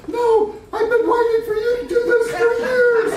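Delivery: very high, loud mock-crying voice; hammed-up wife, high and loud; imitated tearful wife. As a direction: wail it in an exaggerated falsetto. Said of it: "My wife starts crying".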